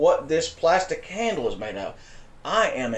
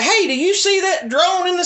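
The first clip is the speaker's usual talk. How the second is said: mock calling out; 4 dB louder than usual. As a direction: act out asking a friend on the phone. Mock phone call to friend.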